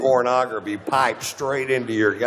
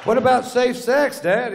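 put-on much higher, louder boyish voice; teen son asking; 12 semitones higher, 5 dB louder than usual. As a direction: pipe up like an eager teenage son. Son asks his dad.

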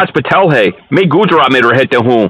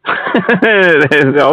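amused chuckle. Real, not acted. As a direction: run of quick chuckles. Amused by an insult in Hindi.

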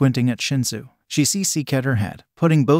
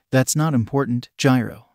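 usual speaking voice

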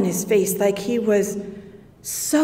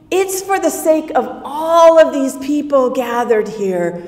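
raised, loud prayer to the sky; 4 semitones higher, 8 dB louder than usual. Acted. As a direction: pray aloud so the crowd hears. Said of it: "after he finished the prayer".

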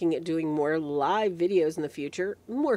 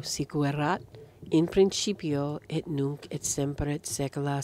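low, even recitation in Latin; measured liturgical recitation; Latin prayer; 5 semitones lower than usual. Real, not acted. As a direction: pray it solemnly on a lowered, level pitch.

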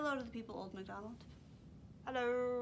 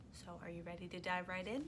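ordinary delivery

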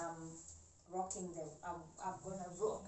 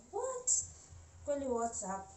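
shocked high What; surprised; 6 semitones higher than usual. Retold or imitated. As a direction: squeak out a stunned what. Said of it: "and I was like".

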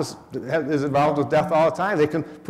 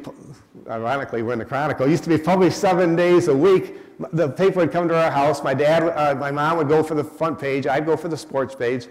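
ordinary talk, nothing marked

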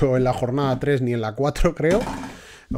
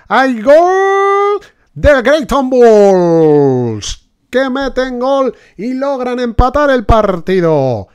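high, loud goal call; breakneck goal commentary; 11 semitones higher, 9 dB louder, much slower than usual. Pretend. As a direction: announce the goal like live radio.

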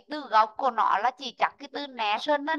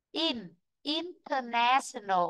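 "In" said lightly, then "international" slow and a touch softer; light first syllable; 4 dB quieter, slower than usual. Shown as a demonstration. Teacher shows the prefix is unstressed, lightly and slowly. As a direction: keep "In" light, stress on "na". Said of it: "trọng âm ở đây là nhẹ này".

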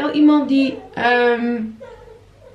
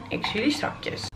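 whisper; 9 dB quieter than usual. Real, not acted. Aside while stirring.

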